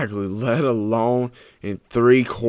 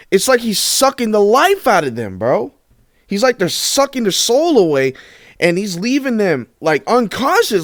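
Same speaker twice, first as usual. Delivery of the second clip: rising, much higher and hyped; excited rant; 9 semitones higher than usual. Real, not acted. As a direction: get more and more worked up. Hyped rant about Bird's dominance.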